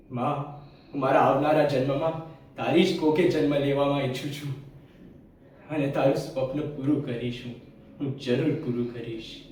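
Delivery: low, soft promise to mother; tender vow. Acted. Dead son's closing vow to mother.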